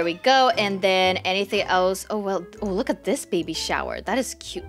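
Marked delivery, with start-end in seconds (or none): none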